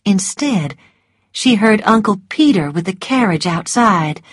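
ordinary delivery

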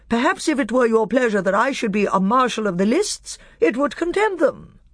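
shrill, high, gallant mouse; Reepicheep. A narrator's reading. Mouse petitioning the king to be marshal, shrill and proud. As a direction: pipe it with proud formality. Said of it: "a shrill voice".

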